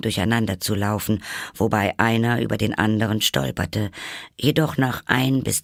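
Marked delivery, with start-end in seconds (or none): none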